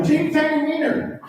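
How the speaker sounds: mock yell. Wife yelling the pet phrase at him, in a playful high mock yell. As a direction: imitate his wife hollering it across the room. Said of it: "she would start yelling".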